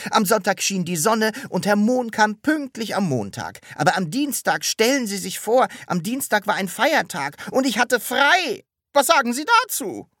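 high, loud, building in indignation; agitated recounting. A narrator's reading. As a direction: build to an outraged appeal to the listener. Recounts an outrageous week, building indignation. (aufgeregt empört)

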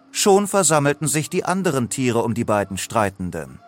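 ordinary delivery

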